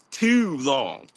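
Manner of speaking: slow "too long" with long stretched vowels, consonants barely said; drawn-out Baltimore "too long". Shown as a demonstration. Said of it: "we stress the vowels".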